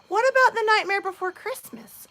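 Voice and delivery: put-on high, bright little-girl voice; quoting the youngest daughter in a high child voice; child's voice. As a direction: mimic the small daughter asking. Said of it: "my youngest daughter looks at me and she goes".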